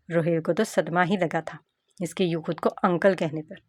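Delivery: plain reading voice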